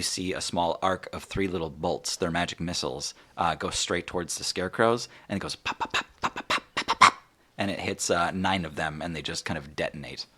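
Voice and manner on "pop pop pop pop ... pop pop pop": rapid unvoiced lip pops; imitated popping. Narrator imitating magic missiles striking scarecrows, rapid pops. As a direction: pop out little bursts in threes.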